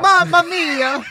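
high, hammed-up Italian accent; mock Italian accent. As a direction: put on a comic Italian mamma-mia accent. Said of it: "You Italian now?".

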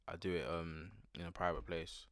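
usual speaking voice

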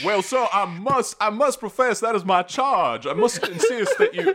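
a high, hammed-up proper gentleman protesting; pompous formal voice. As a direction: insist grandly in a high, drawn-out voice. Player voicing a formal charge.

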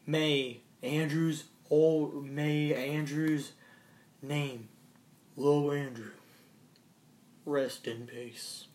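slow, soft mock eulogy; solemn memorial, half joking.

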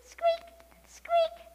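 piercingly high, loud squeaks; nervous mouse squeaks. A narrator's reading. Mouse answers the kitten with nervous, high squeaks. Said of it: "said the mouse nervously".

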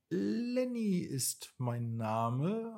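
a bit shy. A narrator's reading. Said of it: "antwortete der Hummer ein bisschen schüchtern".

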